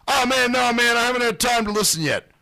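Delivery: high-pitched put-on excuse voice; mock someone pleading no time, pitched well up; mimicked excuse. Acts out host's excuse.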